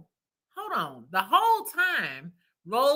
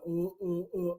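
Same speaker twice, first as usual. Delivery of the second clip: softer, lightly voiced clueless stammer; dumb stammer; 4 semitones lower, 9 dB quieter than usual. Mocking Eddie sounding dumb at the reunion, soft stammer. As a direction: play a guy with nothing to say, a hint of dopey. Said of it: "sounded dumb".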